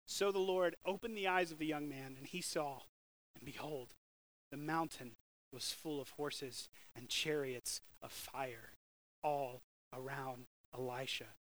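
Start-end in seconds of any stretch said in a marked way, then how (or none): 2.2-11.4 lower, softer, slowing; hushed awe. A narrator's reading. Preacher telling the servant's vision, hushed and awed. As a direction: tell it softly, in wonder.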